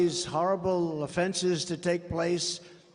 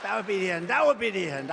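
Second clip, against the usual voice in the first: ordinary talk, nothing marked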